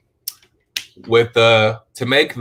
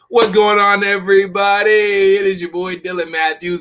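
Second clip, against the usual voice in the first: YouTuber intro; 9 semitones higher than usual. Pretend. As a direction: hype up the channel intro. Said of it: "I gotta start off by saying".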